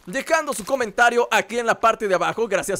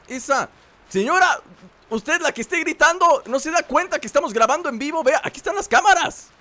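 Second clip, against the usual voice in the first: mock scolding; 7 semitones higher than usual. Scolding the screaming woman.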